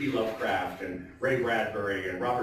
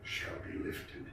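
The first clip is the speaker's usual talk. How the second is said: fading to a whisper; 6 semitones lower, 8 dB quieter than usual. Acted. Host ending The Raven for the audience, fading whisper. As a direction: lower and softer, ending voiceless.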